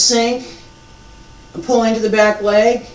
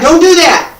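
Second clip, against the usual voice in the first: louder, higher stern warning with "do" stressed; emphatic warning; 8 semitones higher, 7 dB louder than usual. Shown as a demonstration. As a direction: hit "do" hard to warn. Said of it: "Let me emphasize this again".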